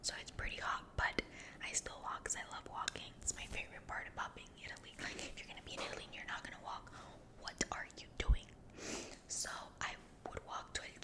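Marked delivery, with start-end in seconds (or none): none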